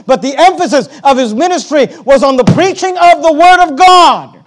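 loud, high, driving sermon delivery; fiery pulpit voice; full-force preaching. Acted. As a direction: preach it hard to the congregation.

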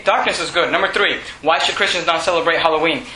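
normal talking voice

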